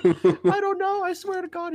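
high-pitched panicked victim; mock pleading. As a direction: plead high, in pretend terror. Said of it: "He's like".

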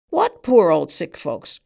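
loud, slow outcry of disbelief; cried out. A narrator's reading. Man on the stool crying out at the barman, loud and slow. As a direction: cry it out, dismissing the question. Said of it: "cried the man on the stool".

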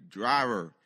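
loud, projected trooper's order; booming official command to a driver; loudspeaker announcement. Retold or imitated. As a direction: imitate a cop on a loudspeaker. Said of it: "gets on a loudspeaker".

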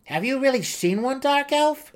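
high, loud eager gnome voice. A narrator's reading. Seldig eagerly asks the dark elf, high and loud. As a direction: ask it breathless with wonder. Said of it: "Seldig asked".

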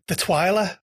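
Twiler with a long "i", as in "tile"; variant pronunciation. Shown as a demonstration. Host offering a misheard surname to the guest, Twiler said as TWY-luh. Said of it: "I've heard people call it".

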